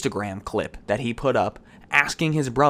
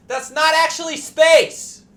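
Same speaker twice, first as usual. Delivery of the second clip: high, loud exasperated shout at Jeff; mock outraged yell; 5 dB louder, slower than usual. Ranting host yelling straight at Jeff Bezos, exasperated shout. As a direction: yell it at him like he's an idiot.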